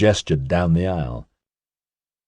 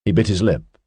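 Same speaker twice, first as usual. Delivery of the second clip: ordinary delivery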